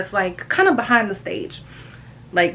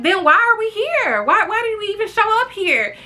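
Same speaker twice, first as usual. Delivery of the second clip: higher, baffled demand; mock exasperation; 11 semitones higher than usual. To Oz and Brainiac at IHOP, higher baffled disbelief. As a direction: ask in disbelief. Friends suddenly not hungry.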